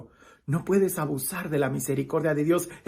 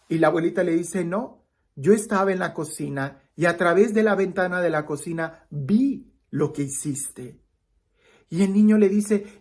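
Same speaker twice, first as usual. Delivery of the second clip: animated storytelling, higher and brighter; lively story narration; 4 semitones higher, 5 dB louder than usual. Acted. Preacher telling a grandmother's story to his viewers, animated. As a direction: tell it like a parable to a congregation.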